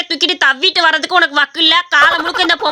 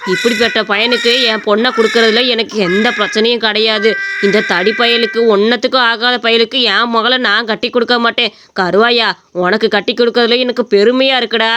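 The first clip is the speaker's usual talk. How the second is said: put-on lower, approving old man; old father approving the new son-in-law; pleased old father; 6 semitones lower than usual. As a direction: praise the hero like a proud old father.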